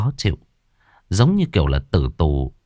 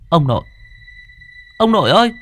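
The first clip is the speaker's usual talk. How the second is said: high, loud cry of grandpa's name; startled call; 12 semitones higher, 4 dB louder, faster than usual. A narrator's reading. Boy startled by grandfather's ghost, calls out loudly. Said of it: "tôi thẳng thốt gọi".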